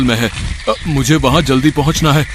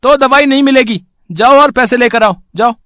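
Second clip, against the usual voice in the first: much higher, louder shopkeeper driving him off; rude shopkeeper; 12 semitones higher, 6 dB louder than usual. Acted. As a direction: shoo the customer away, high and loud. Shopkeeper shooing Vipin away. (ग़रीब ग्राहक को भगाता लालची दुकानदार, ऊँची तेज़ आवाज़ में)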